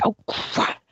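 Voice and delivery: breathy whisper of dismay; whispered oh crap. Retold or imitated. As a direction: whisper it under your breath. Realizing fear blocked contact.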